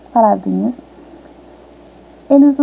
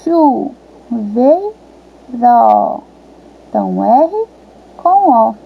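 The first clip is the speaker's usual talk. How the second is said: chuveiro stretched very slowly, syllable by syllable; much slower than usual. Shown as a demonstration. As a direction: draw out each syllable of chuveiro for a child. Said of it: "você vai completar o pedacinho que tá faltando".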